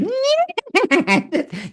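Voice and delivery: high-pitched whinnying cackle; demonic laughing neigh. Retold or imitated. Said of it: "a demon's laugh".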